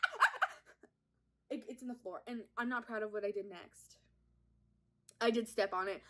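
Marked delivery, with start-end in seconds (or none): 0.0-0.5 very high short screams; acted high-pitched yelps; freaked-out shrieks. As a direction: squeal in staccato panic. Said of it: "freaked out. I'm like".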